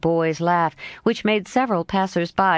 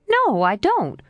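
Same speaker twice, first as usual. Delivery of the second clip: lightly voiced lively girl, a bit higher; a hint of a frank young girl, pitched up; Jo's voice; 8 semitones higher, slower than usual. Jo answering her friend Laurie, lightly voiced girl.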